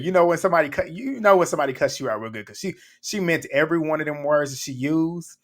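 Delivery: ordinary talk, nothing marked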